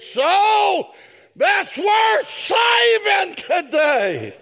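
rising sermon call; preaching shout. Real, not acted. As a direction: lean hard into "saving".

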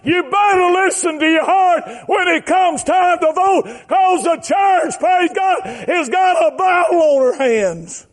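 high, slow preacher's cry; high-pitched pulpit exhortation; full-force preaching. Real, not acted.

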